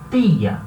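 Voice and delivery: tia com T seco, sem "tchi"; dry T. Shown as a demonstration. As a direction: diga tia com T duro como em espanhol. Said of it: "é o T seco". (T seco em tia)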